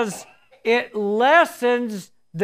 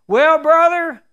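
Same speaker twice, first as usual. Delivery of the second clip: joyful greeting; 7 semitones higher, 7 dB louder than usual. Retold or imitated. Pastor calls, weeping with joy.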